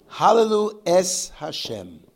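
slow, loud, high start coming down; loud drawn-out declaration, easing off by the end; proclaimed praise. Shown as a demonstration. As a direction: proclaim it, starting big on Hallelu. Demonstrates the fading praise.

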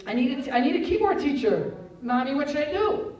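put-on high, loud child's plea; acted child asking for rescue; needy child. Acts child pleading with mother.

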